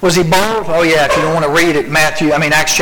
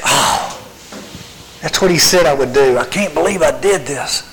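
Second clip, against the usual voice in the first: acted dejection, sagging after anger; understated, crestfallen self-reproach; sad, defeated. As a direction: sink into regret and disbelief. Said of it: "now he's so sad and he's defeated".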